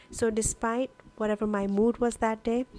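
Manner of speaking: ordinary talk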